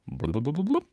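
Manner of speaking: soft mouth drum blubs; bongo noises. Pretend. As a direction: tap out a bongo beat with your lips.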